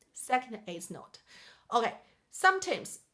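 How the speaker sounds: ordinary talk, nothing marked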